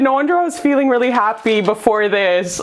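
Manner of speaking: usual speaking voice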